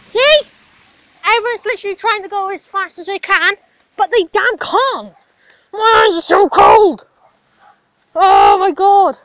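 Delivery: loud, thrilled yelling; excited shouting. Real, not acted. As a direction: shout out in delight.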